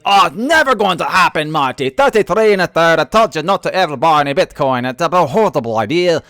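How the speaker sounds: mock Warren Buffett as a loud stage Irishman. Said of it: "I don't know why Warren Buffett's Irish now".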